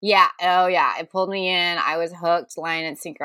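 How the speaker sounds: ordinary delivery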